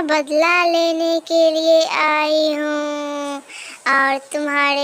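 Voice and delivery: slow, eerie ghost voice; drawn-out vengeful ghost; spooky slow revenge speech. Acted. Ghost daughter reveals revenge.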